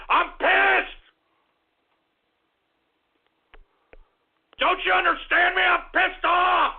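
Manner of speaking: anger building to a high shout; pissed off. Real, not acted. Host fed up with his show, building to furious shouting. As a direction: start contained, then burst into an angry yell. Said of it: "I'm so pissed off".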